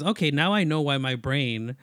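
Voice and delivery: ordinary delivery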